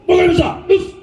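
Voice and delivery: high imitating voice of a hurried senior; senpai's high voice. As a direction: squeak it like a startled senpai. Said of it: "He goes".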